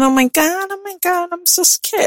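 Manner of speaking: high, soft, breathy-sounding fake fright; fake scared. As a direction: play helpless to lure him over. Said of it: "I use it to my advantage".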